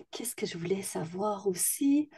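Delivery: hushed, half-whispered aside to herself; thinking aloud. Real, not acted. Host wondering what else to ask her guest, hushed self-talk. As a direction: murmur it under your breath, searching your memory.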